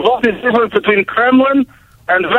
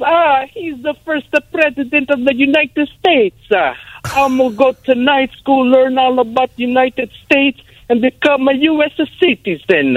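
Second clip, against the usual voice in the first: Giuseppe's voice; 5 semitones higher than usual. Retold or imitated. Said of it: "He says".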